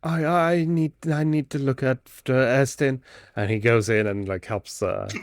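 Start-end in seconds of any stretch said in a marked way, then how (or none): 0.0-3.0 mildly flustered, higher-pitched stammer; scared stammer. Acted. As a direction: stumble on the first word, a touch afraid. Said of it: "Ren definitely scares him".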